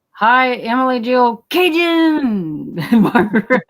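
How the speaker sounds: drawing out the name with a laugh; cheery greeting. Retold or imitated. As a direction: call the names out warmly and slowly. Reading chat greetings cheerfully.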